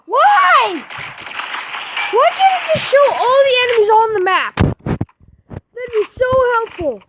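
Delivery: high-pitched frustrated whining protest; exasperated outburst. Real, not acted. Player griping about the map, exasperated high complaint.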